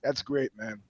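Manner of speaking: a sour shopkeeper under his breath; bitter butcher. Retold or imitated. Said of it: "the butcher is looking at me through the window like".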